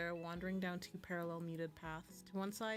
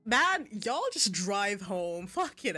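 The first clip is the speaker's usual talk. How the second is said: drawn-out, much louder outburst; loud exclamation; 12 dB louder, slower than usual. Real, not acted. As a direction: exclaim loudly, stretching the words. Reader breaks off to exclaim.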